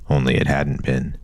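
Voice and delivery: plain reading voice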